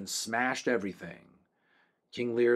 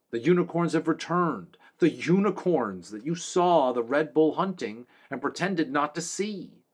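raised, reproachful king chastising the town; lightly voiced scolding king; 6 semitones higher, 5 dB louder than usual. A narrator's reading. A king berates the townsfolk, raised scolding voice. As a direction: scold the silly people from above.

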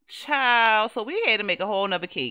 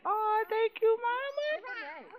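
put-on high, squeaky little girl thanking mama; child voice; 13 semitones higher, 4 dB quieter, much slower than usual. Player voicing the toddler thanking mom, high and sweet. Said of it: "she like".

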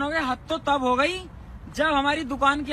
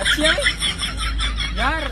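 squeaky high laugh through the words; 11 semitones higher, much slower than usual. Real, not acted. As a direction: crack up while saying it. Laughing reply to joke.

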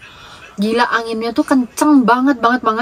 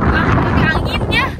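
talking over roaring wind; window down on highway; 4 semitones higher, 4 dB quieter than usual. Real, not acted. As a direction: shout over strong wind outdoors. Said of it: "karena anginnya kenceng banget".

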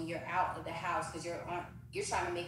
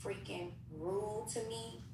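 ordinary delivery

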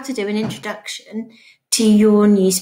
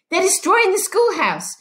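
high, breathless alarm; gasping for breath; 11 semitones higher than usual. Acted. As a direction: blurt it out high, as if winded from running. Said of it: "gasping for breath".

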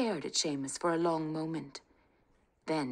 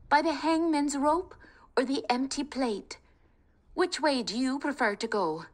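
put-on higher, urgent young Kate; rally the family, high and eager; spirited young woman; 9 semitones higher, 4 dB louder than usual. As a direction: bold daughter talking her family round. Kate voiced.

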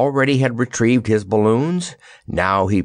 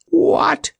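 very high, startled "what"; exasperated gasp; 3 dB louder than usual. A narrator's reading. Jellia hears of a new threat, exasperated gasp. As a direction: squeak it out in exasperated disbelief. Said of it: "gasped Jellia in an exasperated voice".

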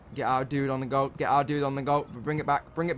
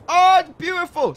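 excited; 13 semitones higher, 8 dB louder than usual. Real, not acted. Celebrating goal.

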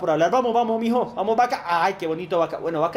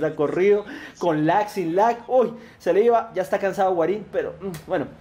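normal talking voice

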